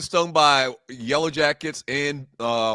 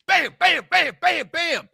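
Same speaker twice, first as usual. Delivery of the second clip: sharp, high bursts on each hit; staccato impact sounds, pitched up; rapid stings acted out; 11 semitones higher, slower than usual. Acts out being stung repeatedly.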